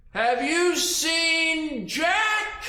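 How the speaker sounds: very drawn-out, loud, high question; slow booming giant call; giant's shout. Acted. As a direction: stretch every word into a giant bellow. Giant questions tiny creature.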